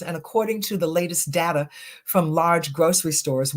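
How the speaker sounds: ordinary talk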